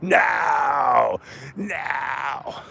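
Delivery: high, stretched-out movie yell, fading on the repeat; Doctor Loomis yelling. Retold or imitated. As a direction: imitate a horrified cry up the stairs, held back in volume. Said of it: "Doctor Loomis is, is yelling up the stairs".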